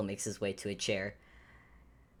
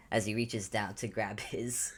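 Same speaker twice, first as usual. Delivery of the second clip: ordinary delivery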